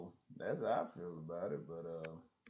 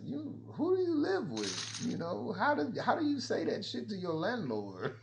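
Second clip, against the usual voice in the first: much higher, louder mock disbelief; incredulous; 13 semitones higher, 6 dB louder than usual. To the quitter, high loud disbelief. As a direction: hammer him with disbelief. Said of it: "I said".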